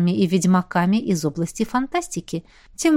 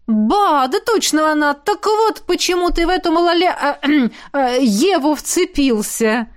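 high, blurting recognition that stumbles over a word; excited exclamation; 7 semitones higher than usual. Acted. Molly recognising the famous girl, excited high blurt. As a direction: realise it all at once. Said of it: "воскликнула Молли".

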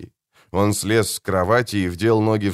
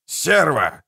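sharp curse; 7 semitones higher than usual. A narrator's reading. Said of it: "назвал он её тогда".

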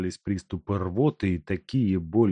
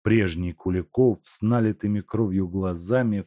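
usual speaking voice

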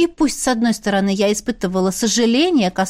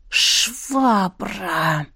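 hissed. Acted. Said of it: "натурально прошипела я".

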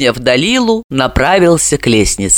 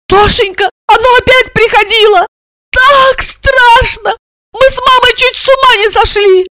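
very high, whimpering lament; high-pitched frightened lamenting; tearful wailing; 5 dB louder than usual. A narrator's reading. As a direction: wail it through tears. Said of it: "заплакала и запричитала".

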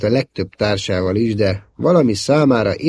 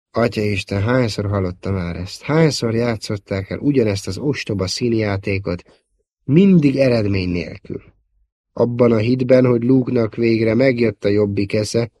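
usual speaking voice

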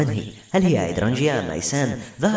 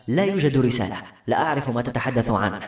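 ordinary delivery

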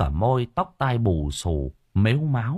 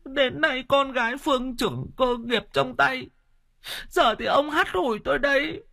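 much higher, crying complaint; wailing wife. A narrator's reading. Old wife lamenting to her husband, wailing and crying. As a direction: sob out years of grievance. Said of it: "bà Linh khóc lóc".

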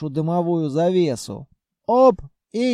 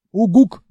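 grunt; 4 semitones higher, 8 dB louder than usual. Acted. Character answers with a grunt.

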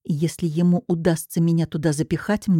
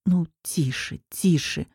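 softer hushing to calm her; soothing; 4 dB quieter, slower than usual. Acted. Maxim holding the sobbing girl, soothing hush. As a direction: gently hush a crying girl.

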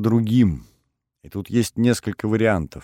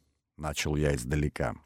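ordinary delivery, nothing marked

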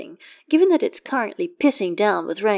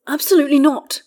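sharp refusal, higher and louder; snapping; 9 semitones higher, 7 dB louder than usual. A narrator's reading. Astarion refuses Gale's offer to join him, high loud snap. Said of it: "Astarion snaps".

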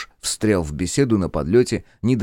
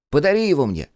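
whining, high and loud; whiny kid; 8 semitones higher, 4 dB louder than usual. A narrator's reading. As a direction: beg like a whiny child. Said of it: "ныл он".

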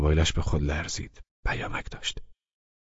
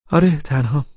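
put-on higher young woman's voice, simply; Laleh's voice; 9 semitones higher, 6 dB louder than usual. A narrator's reading. Laleh telling Abbas she's alone, pitched up.

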